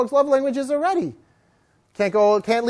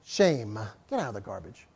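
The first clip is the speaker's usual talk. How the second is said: low guttural; 12 semitones lower, 11 dB quieter than usual. Shown as a demonstration. Trainer shooing dog from garbage, low guttural reproof. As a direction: warn the dog quietly, deep in the throat. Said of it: "Three guttural ways".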